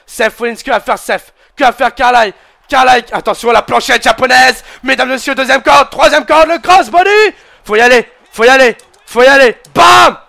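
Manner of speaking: hyped French wrestling commentator calling every move; live play-by-play. Acted. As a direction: call the match like a ringside announcer.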